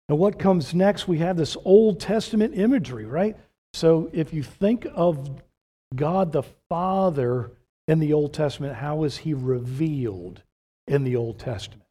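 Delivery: lively pulpit delivery; fervent preaching. Acted. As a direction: drive the question to the crowd. Preaching raised voice.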